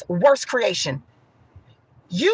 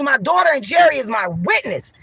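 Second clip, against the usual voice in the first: usual speaking voice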